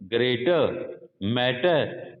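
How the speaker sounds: RP-style ग्रेटर, मैटर with the r silent; no final r. Shown as a demonstration. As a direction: drop the r at the end of ग्रेटर, मैटर. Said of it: "actual इसका जो pronunciation होएगा".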